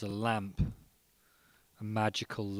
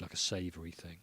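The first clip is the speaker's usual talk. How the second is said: whispered; 5 semitones lower, 7 dB quieter than usual. Acted. Unvoiced whisper at line end.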